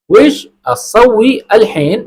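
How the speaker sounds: slow "وش اسوی الحین" with each word set apart; correct form. Shown as a demonstration. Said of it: "یہ نہیں بولنا". ("وش اسوی الحین" درست، آہستہ اور الگ الگ)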